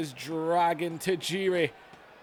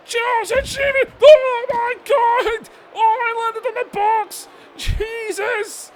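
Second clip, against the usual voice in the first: stunned yelling; 12 dB louder, slower than usual. Real, not acted. Wrestler thrown off the truck.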